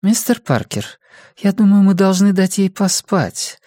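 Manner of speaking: high-pitched voice of a nurse interjecting. A narrator's reading. Nurse asks visitor to let patient sleep, as nurse. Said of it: "подала голос медсестра".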